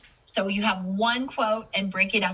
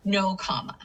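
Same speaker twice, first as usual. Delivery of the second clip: normal talking voice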